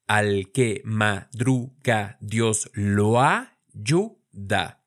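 "Al que madruga, Dios lo ayuda" spoken slowly with "lo ayuda" joined; syllable by syllable, linking "lo ayuda" into one; slow syllable split. Shown as a demonstration. Teacher showing syllables and vowel linking in a proverb. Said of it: "vean que decimos lo ayuda".

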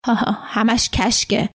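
ordinary delivery, nothing marked

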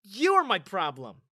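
loud, scornful comeback; Ruby snorting back at Olivia, loud and scornful; snorting retort. Acted. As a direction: snap it back with contempt. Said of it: "Ruby snorted".

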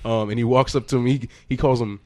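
ordinary delivery

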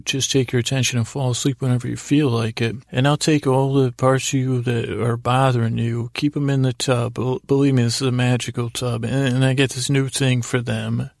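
usual speaking voice

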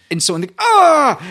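high sudden yell on the ah; loud burst as the knife goes in; mock attack cry; stabbing yell. Miming a stabbing in game.

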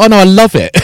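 high, loud delight; mock gleeful. Telling a colleague he loves the fight, high and gleeful. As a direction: gush it up high and bright. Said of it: "I was like".

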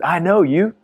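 imitating an American, pitched high and rising; big American accent. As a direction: put on a loud-mouthed American voice. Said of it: "in a big American accent".

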